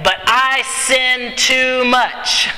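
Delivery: high, drawn-out objection; voicing a self-doubting believer, slow and high; tempted believer's protest. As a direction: stretch it out as a pleading protest. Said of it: "when you are tempted to say".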